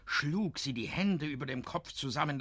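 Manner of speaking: ordinary delivery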